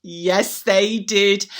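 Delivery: ordinary delivery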